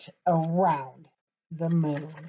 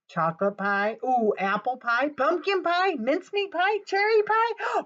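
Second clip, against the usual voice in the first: excited; 6 semitones higher than usual. Acted. Excitedly listing pies.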